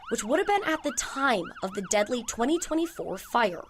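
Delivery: measured, emphatic reporter voice-over; TV news read. A narrator's reading. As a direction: deliver it as an investigative news report. Reporter narrates a news package.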